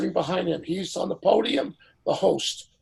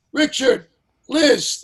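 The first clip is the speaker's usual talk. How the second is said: calling out names, higher and louder; 8 semitones higher, 8 dB louder, slower than usual. Retold or imitated. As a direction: summon the stars like the host in charge. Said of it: "So he says".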